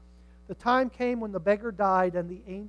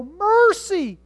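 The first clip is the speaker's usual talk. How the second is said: high, loud plea for mercy; crying out to God; 11 semitones higher, 14 dB louder than usual. Acted. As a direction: call it up to God at full voice. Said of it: "cry out to God".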